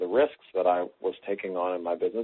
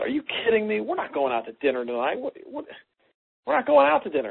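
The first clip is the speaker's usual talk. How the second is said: retold half-yell, pitched much higher; exasperated outburst at the wife, held just short of a real yell; almost yelling; 12 semitones higher than usual. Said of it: "I almost yelled at her".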